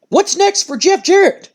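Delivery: high, slow, earnest interviewer question; interviewer's question. Retold or imitated. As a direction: lift it up like a reporter asking. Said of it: "And Cole goes".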